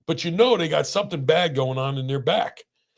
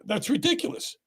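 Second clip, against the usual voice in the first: incredulous; 14 semitones higher, 4 dB quieter than usual. Real, not acted. Outrage at therapy requirement.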